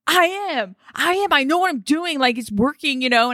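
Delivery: defensive retort. Retold or imitated. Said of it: "I'm like".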